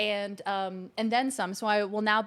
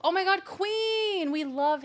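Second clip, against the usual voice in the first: high, drawn-out squeal of delight; excited gushing; 8 semitones higher, 3 dB louder, slower than usual. Real, not acted. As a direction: gush slowly and high over great news. Gushes over viewer's news.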